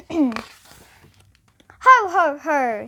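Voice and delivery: lightly voiced jolly Santa ho ho ho; a touch of booming Santa; hint of jolly Father Christmas; Santa laugh. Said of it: "said Santa".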